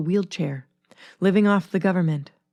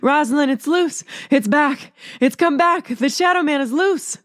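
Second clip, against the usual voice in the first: high, urgent panic; frantic warning; 8 semitones higher than usual. A narrator's reading. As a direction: warn her in rising fright, much higher. Terrified Evelyn reports the Shadow Man.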